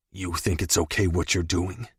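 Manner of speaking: low, quiet, calm menace. Acted. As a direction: ask it coldly and calmly. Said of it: "I asked calmly".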